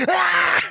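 high shrieking yell; crazy lady jumping out, high scream; mock scream. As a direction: leap out with a shriek. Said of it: "crazy lady ... is like".

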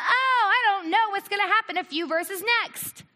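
loud, high-pitched mock panic; exaggerated distraught cry, acted out. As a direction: wail it in hammed-up worry. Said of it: "crying because he went".

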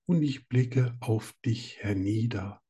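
slow, measured poem reading; poem recital. Acted. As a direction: recite it slowly and tenderly. Reads his own poem.

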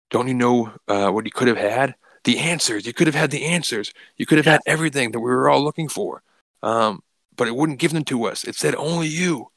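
a crazed cultist who can't believe what he lost; shocked, betrayed killer. Retold or imitated. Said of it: "shocked and betrayed".

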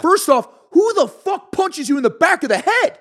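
loud, high-pitched outrage; indignant outburst. Real, not acted. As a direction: raise your voice in real disbelief. Indignant about the sucker punch he took.

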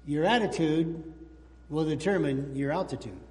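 slow, weighty punchline, low; slow key line. Shown as a demonstration. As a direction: land the key point slowly. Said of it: "here's the key".

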